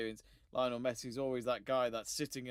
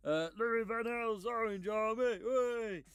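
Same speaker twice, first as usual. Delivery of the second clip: higher, slow Dutch coach impression; Louis van Gaal voice; 9 semitones higher, 4 dB louder, slower than usual. Shown as a demonstration. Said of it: "let me say that in a Louis van Gaal voice".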